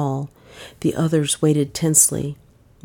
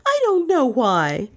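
wild cry; 8 semitones higher than usual. Acted. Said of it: "she cried wildly".

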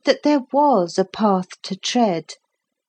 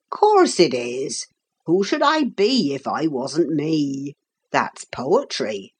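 low, slow workman's banter; jovial old carpenter; 4 semitones lower than usual. Acted. Carpenter joking with the astonished boy, jovial. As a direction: tease along in a low rustic voice.

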